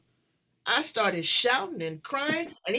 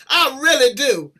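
louder, laughing through the apology; 5 semitones higher, 8 dB louder than usual. Real, not acted. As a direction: laugh helplessly while apologizing. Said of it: "I hate to laugh in your face".

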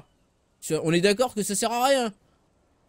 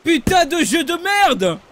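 louder and slow, swearing at the game; angry outburst; 5 semitones higher, 3 dB louder, slower than usual. Real, not acted. Frustrated streamer raging at the game, angry swearing.

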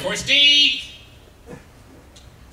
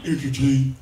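ventriloquist dummy voice; 6 semitones lower, slower than usual. Acted. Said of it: "he might sound a little something like this".

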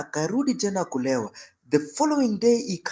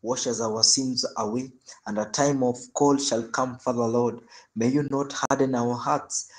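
prayer; 4 semitones lower than usual. Acted. Closing prayer.

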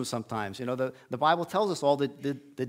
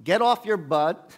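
"Get off your butt" barked loud and slow; scolding shout; 4 semitones higher, 5 dB louder, slower than usual. Retold or imitated. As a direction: push him to move, loudly. God scolding Moses in retelling.

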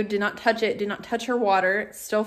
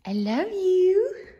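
slow, much higher doting coo; high dog coo; 10 semitones higher, much slower than usual. Real, not acted. Cooing to her dog.

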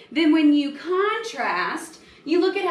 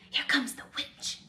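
hushed whisper, much softer; 3 semitones higher, 7 dB quieter than usual. Acted. Kids hearing footsteps in the hall, whispering. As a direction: whisper so the witch can't hear. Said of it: "they whispered".

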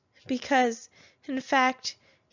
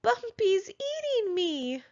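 high, slow, panicked little voice; alarmed cry; 10 semitones higher than usual. A narrator's reading. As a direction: wail it in alarm. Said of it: "she shrieked".

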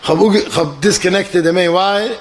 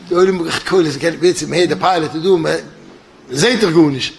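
normal talking voice

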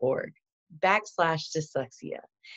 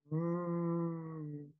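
R sound demo; 12 dB quieter than usual. Shown as a demonstration. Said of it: "voiced or unvoiced".

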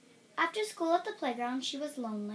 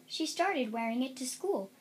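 ordinary delivery

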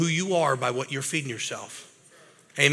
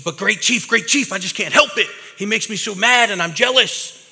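put-on higher, louder whiny kid; pleading young kid; 6 semitones higher, 5 dB louder than usual. Angry boy pleads with the chief, higher and louder. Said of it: "And he says".

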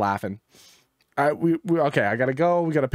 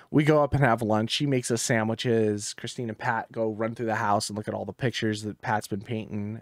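usual speaking voice

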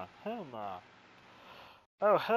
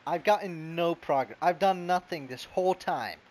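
frustrated complaint; 7 dB louder than usual. Real, not acted. Frustrated complaint while gaming.

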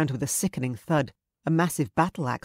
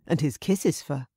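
ordinary delivery, nothing marked

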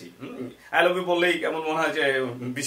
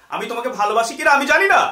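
higher, louder, flustered outburst; exasperated confusion; 6 semitones higher, 8 dB louder than usual. Acted. Teacher acts a confused lover, blurting loudly. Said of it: "confused".

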